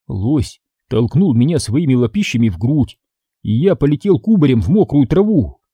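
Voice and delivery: usual speaking voice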